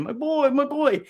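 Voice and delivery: put-on very high, squeaky German-accented old man; a creepy, high, hammed-up German-accented "my boy"; mock German accent. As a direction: imitate Tom Hanks doing a weird German accent. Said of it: "with a weird German kind of accent saying".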